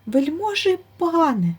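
high, timid and very polite hare voice; frightened hare pleading with the bear; most politely. A narrator's reading. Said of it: "найчемніше".

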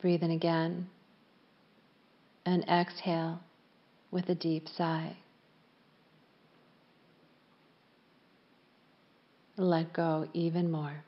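calm yoga nidra voice with long pauses; unhurried, evenly pitched relaxation guidance; guided meditation. Real, not acted. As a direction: guide them gently into stillness, leaving space after each phrase.

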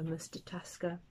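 soft whisper of pity; hushed whisper; whispered name. A narrator's reading. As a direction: breathe his name in a hush. Pity for exhausted hero.

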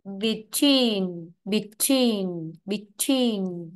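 "between" with a long, falling second syllable; teacher drilling "between" as be-TWEEN; long stressed EE. Shown as a demonstration. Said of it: "y dài".

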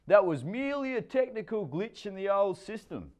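high, grand mock-formal voice; pompous bluster. A narrator's reading. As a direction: play it like a puffed-up expert. David pompous.

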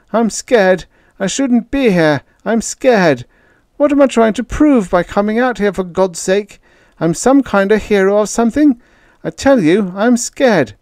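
high, loud tearful wail; bawling. A narrator's reading. Frightened kid bawling to Peter at night. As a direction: break down crying in fear. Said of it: "bawling like a baby".

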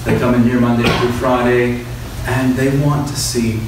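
ordinary talk, nothing marked

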